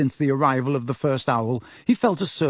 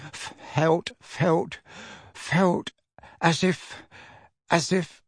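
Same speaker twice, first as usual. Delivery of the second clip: halting, higher, hushed stammer; trembling; 4 semitones higher, 4 dB quieter, much slower than usual. A narrator's reading. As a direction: grope for words, repeating them shakily. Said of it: "still trembling".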